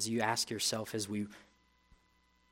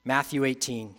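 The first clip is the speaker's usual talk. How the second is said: ordinary talk, nothing marked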